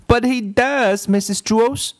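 put-on high whiny girl voice; Leslie. A narrator's reading. Girl protests to her teacher, high and whiny.

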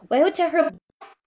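teacher stressing "boss" for the class. Shown as a demonstration. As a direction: lean hard on the word "boss". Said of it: "那在这时候我们就强调boss".

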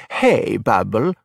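put-on slow, fond old grandma greeting; old grandma greeting her grandson, slowly. A narrator's reading. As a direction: draw it out like a gentle grandmother.

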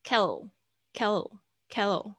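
kettle with the middle T softened almost to an L; flapped t demo. Shown as a demonstration. Teacher drills kettle with a flapped T, slow and clear. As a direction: say kettle slowly, the T voiced like a light L. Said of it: "它会浊化，变成很像另外一个L的声音".

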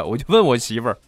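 normal talking voice

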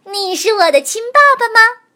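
put-on high, loud hopeful baby voice; thin reedy voice. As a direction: ask like an eager hatchling. Hatchling asks the frog.

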